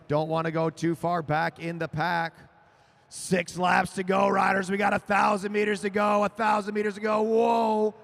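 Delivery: announcer ramping up as the laps count down; building race call. Real, not acted. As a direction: call it with rising urgency.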